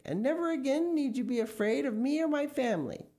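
high, pleading assurance; earnest high-voiced vow; fervent promise. A narrator's reading. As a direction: reassure them urgently, pitch up. Mister Gregg promising under threat.

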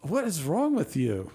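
high, appalled squeal of disbelief; disgusted disbelief. Real, not acted. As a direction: react as if it's revolting, slow on ketchup. Horrified at cheese-ketchup habit.

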